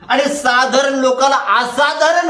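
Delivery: slow, drawn-out, emphatic refrain; rhythmic preaching. Real, not acted. Preaches in repeated phrases.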